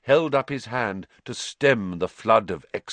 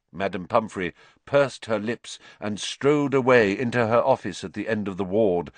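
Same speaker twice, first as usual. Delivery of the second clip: usual speaking voice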